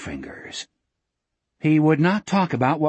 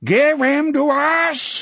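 slow, high monster howl; drawn-out high creature scream; laughing, howling scream; 13 semitones higher, 4 dB louder, slower than usual. Acted. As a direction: howl it out like a taunting fiend. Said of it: "another laughing, howling voice from outside screamed".

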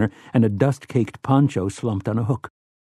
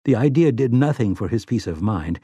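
ordinary delivery, nothing marked

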